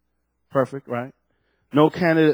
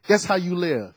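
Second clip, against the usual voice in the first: usual speaking voice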